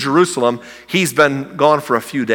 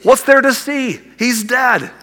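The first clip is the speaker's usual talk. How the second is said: higher, pleading, exasperated questions; exasperated disciples; 10 semitones higher, slower than usual. Retold or imitated. Disciples protest to Jesus about Lazarus, higher exasperated pleading. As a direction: complain like a baffled friend.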